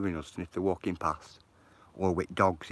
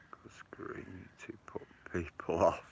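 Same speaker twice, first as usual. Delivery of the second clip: laughing whisper; 6 dB quieter, slower than usual. Real, not acted. Laughing aside.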